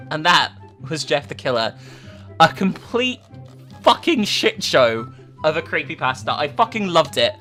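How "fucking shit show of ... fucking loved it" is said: normal talking voice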